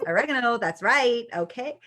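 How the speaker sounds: put-on American stage voice; playful American actor's warm-up; American accent. Shown as a demonstration. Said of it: "I was once in an American play".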